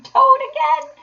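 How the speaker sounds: high, loud triumphant boasting; gleeful, crowing self-praise; ecstatic self-admiration. A narrator's reading. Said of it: "he cried in ecstasies of self-admiration".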